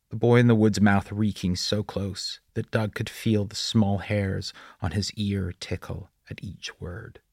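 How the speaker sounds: plain reading voice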